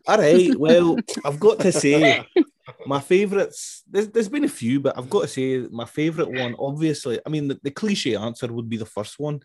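hammed-up Scottish accent that slips as it goes. Host asked him to answer in a Scottish accent. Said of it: "answer this question in your best Scottish accent".

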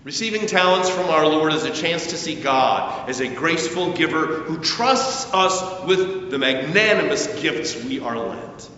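ordinary talk

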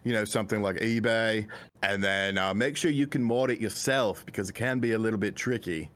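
put-on lightly done British accent, low and easy; a hint of a British tech YouTuber accent; MVG impression. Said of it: "It's like he's really here".

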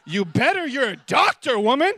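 loud, high mock sass; hammed-up attitude with a laugh; sassy retort. Patient sassing the doctor, loud and cheeky.

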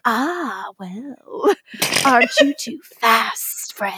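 a hint of a sneering Bond villain, laughing through it. Retelling Klaus to cohosts, mild Bond-villain sneer. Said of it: "Talking like a fucking Bond villain".

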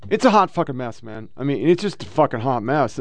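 ordinary talk, nothing marked

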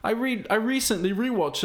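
ordinary talk

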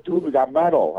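mock excited "metal"; excited nurse. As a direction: brag about the playlist. Nurse voiced in story.

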